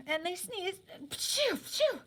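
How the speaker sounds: high mock sneezes, pshoo pshoo; sneeze sound. A narrator's reading. Narrator mimicking the justice and Mister Light sneezing. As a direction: sneeze it out comically twice.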